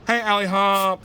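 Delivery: a hammed-up Swedish greeting; mock Swedish. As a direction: greet them like a cheery Swede. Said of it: "That's Swedish for hello".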